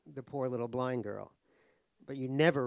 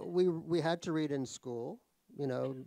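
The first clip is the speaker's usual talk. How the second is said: ordinary delivery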